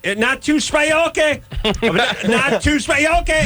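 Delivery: very high clipped order taker; high restaurant worker voice. Retold or imitated. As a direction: squeak out the readback.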